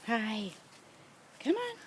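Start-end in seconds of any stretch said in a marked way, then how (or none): 1.4-1.8 high coaxing coo. Real, not acted. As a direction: lure the pup in sweetly. Coaxing puppies.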